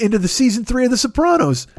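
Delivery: ordinary delivery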